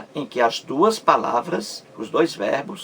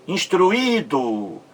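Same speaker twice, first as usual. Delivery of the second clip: Instruído slow and higher, with a long í; stress on second i; 4 semitones higher, much slower than usual. Shown as a demonstration. Teacher showing the sílaba mais forte, Instruído with stretched í. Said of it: "A sílaba mais forte".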